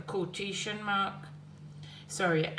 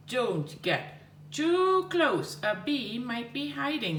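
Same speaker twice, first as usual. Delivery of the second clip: 1 mild shout; 7 semitones higher, 3 dB louder, slower than usual. Shown as a demonstration. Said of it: "Bob shouted".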